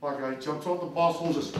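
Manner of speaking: usual speaking voice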